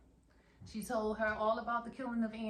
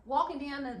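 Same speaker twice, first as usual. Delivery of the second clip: usual speaking voice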